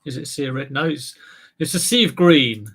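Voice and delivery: usual speaking voice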